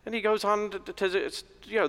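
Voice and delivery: normal talking voice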